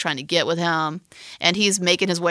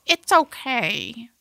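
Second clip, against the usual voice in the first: higher, drawn-out put-on voice; annoying film-character voice; 5 semitones higher, much slower than usual. Woman accepting her lover's apology, in an annoying put-on voice. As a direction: slowly coo it in an exaggerated, irritating pitched-up voice. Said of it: "It's a really annoying voice".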